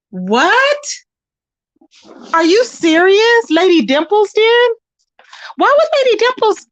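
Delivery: stunned, raising the voice; what, seriously, said high and loud; shocked disbelief. Real, not acted. Shocked reaction to news.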